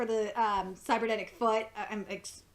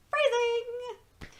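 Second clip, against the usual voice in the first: Archer's catchphrase; 12 semitones higher than usual. Retold or imitated.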